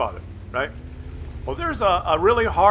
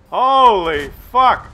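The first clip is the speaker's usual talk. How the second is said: exasperated; 8 dB louder, much slower than usual. Real, not acted. Exasperated outburst after sketch.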